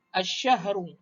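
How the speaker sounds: "Asyahru" with a held double SH; lam dropped, shin doubled. Shown as a demonstration. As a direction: say "Asyahru" with no L, straight into sh. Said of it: "huruf lam ni sebutan dia dihilangkan".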